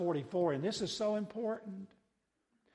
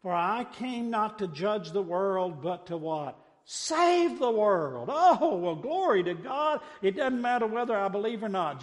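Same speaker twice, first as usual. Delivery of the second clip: raised, ringing pulpit delivery; full-force preaching; 4 semitones higher, 5 dB louder than usual. Real, not acted. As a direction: build to a loud, exultant climax.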